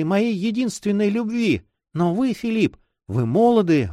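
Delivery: put-on high, plaintive girl in love; devoted young girl. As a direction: vow love in a girlish voice.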